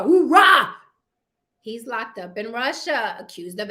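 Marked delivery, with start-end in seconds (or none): 0.0-0.7 loud, high barked hoorah; mock Marine cry. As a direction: shout it like a gung-ho Marine. Marine Corps battle cry.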